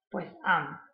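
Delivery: usual speaking voice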